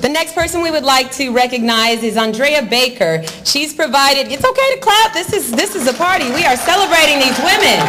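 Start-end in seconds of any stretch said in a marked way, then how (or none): 4.3-7.8 high, excited call for applause; rallying the crowd. Real, not acted. Host tells audience to clap for honored women, rallying the crowd. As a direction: call out to the room like a party host.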